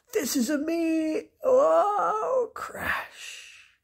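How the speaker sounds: high shout breaking into a cry; a flight turning into a fall; crash mid-boast. Acted. Bernard tries to fly, crashes.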